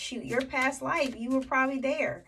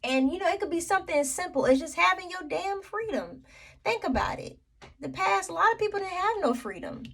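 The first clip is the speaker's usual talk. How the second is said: higher, fast and fired up; impassioned; 7 semitones higher, 4 dB louder, faster than usual. Real, not acted. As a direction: press the point with rising passion. Impassioned point about freedom.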